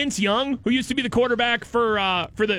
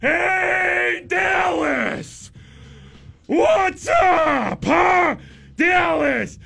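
put-on confused old announcer; 2 semitones higher, 3 dB louder than usual. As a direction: shout like a lost old man at the mic. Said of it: "they just parade him out there just like".

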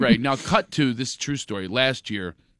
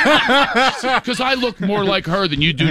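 higher, laughing through a mock boast; laughing mock Linda; 8 semitones higher, 3 dB louder than usual. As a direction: laugh it out as the cocky friend. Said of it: "She goes".

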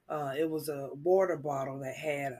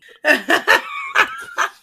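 very high, loud cackle; loud squealing burst of laughter; real laugh; 15 dB louder than usual. Real, not acted. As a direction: shriek with laughter. Laughing at embarrassing story.

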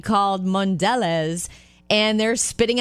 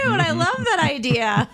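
laughing; 7 semitones higher than usual. Real, not acted. Delighted by frame-a-bill idea.

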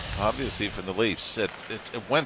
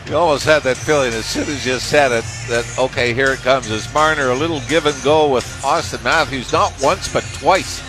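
louder, energetic breakdown; loud replay analysis; 4 semitones higher, 6 dB louder than usual. Real, not acted. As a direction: push the replay with big energy. Colour man on goal replay.

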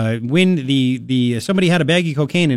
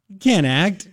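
ordinary talk

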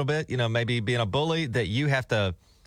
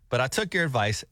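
normal talking voice

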